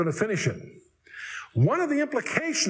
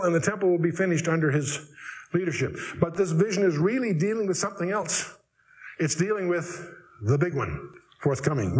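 normal talking voice

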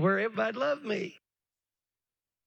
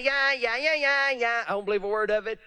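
high, fast scoffing yeahs; dismissive scoffer; 5 semitones higher than usual. Retold or imitated. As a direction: brush it off like a mocker. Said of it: "stand up and say".

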